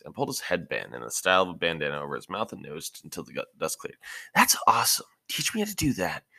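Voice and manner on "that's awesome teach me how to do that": loud and much higher, thrilled; a kid blown away by a trick; excited. Acted. Naruto sees the rock split, excited and loud.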